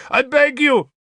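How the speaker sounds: high-pitched pleading shout; shouted protest. A narrator's reading. Bishop begging Arthur at the door, high protesting shout. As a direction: beg loudly, pitch jumping up. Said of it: "Sansom shouted in protest".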